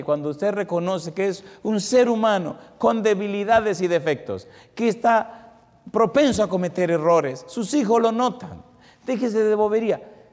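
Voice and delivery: loud, high fiery sermon exhortation; full-force preaching. Acted. A preacher urges parents to be honest, loud and high.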